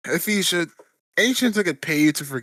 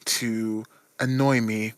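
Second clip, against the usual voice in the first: slow deliberate; slower than usual. Acted. Slow deliberate in-character reply.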